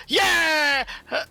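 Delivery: loud cheering yell; crowd cheer. Acted.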